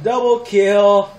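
loud, slow "double kill", no longer screamed; savouring triumph. Real, not acted. Repeats it, settling from the shout.

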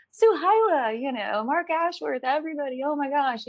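put-on high, delighted hellos to old friends; excited greeting. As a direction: greet friends gladly after isolation. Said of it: "I'm just like".

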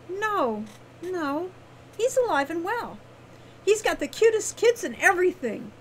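high, playful character voice; playful Wren. A narrator's reading. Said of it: "shook her head playfully".

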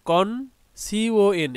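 slow "কন", then letters "সিওএন"; teacher splitting off the first syllable, "কন"; syllable spelling. Shown as a demonstration. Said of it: "এটাকে ভাগ করি".

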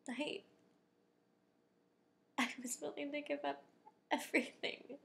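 emphatic, weighted confession in short bursts; intense confession. Acted. Telling a partner of giving up everything, intense confession. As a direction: press each phrase harder, building to the last word.

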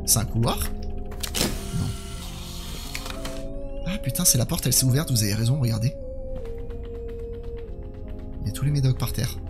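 ordinary talk

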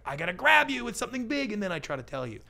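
high, loud attention-grab; mock hard sell. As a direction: play an overeager advertiser pouncing on the listener. Acts out old-style advertiser's hook.

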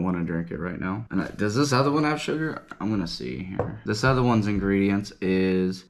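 talking into a glass jar; speaking while sipping. Real, not acted. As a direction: mouth on jar rim. Seen 0: holds jar while talking, lips reach rim near the end.

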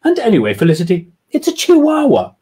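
smug lady voice; smug retort. Acted. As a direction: correct her friend with a smug edge. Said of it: "retorted Jemima smugly".

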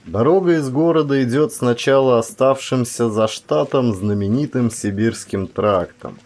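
plain reading voice

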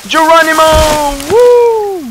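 long, high hollered whoop; gleeful loud whooping; battle cry. Real, not acted. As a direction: holler it like jumping off a cliff. Battle cry while playing.

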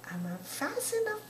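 high-pitched mock question; high teasing repeat. Real, not acted. Teasing baby, higher repeat.

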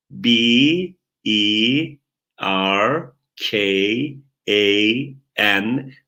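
letter by letter, long pauses between; spelling out. Shown as a demonstration. Teacher models spelling a name for students, spelling out. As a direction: stretch each English letter, then wait. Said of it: "diye heceleyebiliyorsanız".

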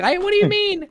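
high, mocking confused squeak; mocking baffled kid. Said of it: "and you're like".

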